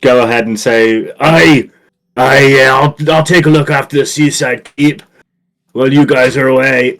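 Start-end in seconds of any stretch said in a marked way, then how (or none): 1.2-6.9 lightly Scottish housekeeper voice; a hint of a Scots housekeeper; broad Scottish accent. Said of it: "She's got a very nice accent".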